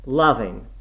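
loud, contemptuous echo; scornful. A narrator's reading. Nurse repeats the girl's word, scornfully. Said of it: "as scornfully as I could utter the word".

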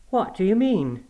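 high, startled question; puzzled housekeeper. A narrator's reading. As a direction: ask in blank surprise.

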